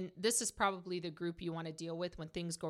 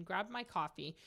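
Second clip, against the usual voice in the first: ordinary talk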